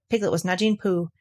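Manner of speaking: ordinary delivery, nothing marked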